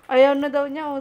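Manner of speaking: loud, amused aside; loud report to the camera crew. Real, not acted. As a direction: call it out to the others.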